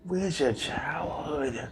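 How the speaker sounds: whispering. Real, not acted. Teasing whispered question.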